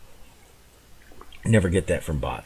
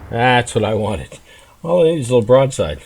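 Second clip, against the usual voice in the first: higher and much louder, fast and thrilled; excited exulting; 7 semitones higher, 10 dB louder than usual. Real, not acted. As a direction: shout it out as the shot lines up. Exulting at the perfect angle.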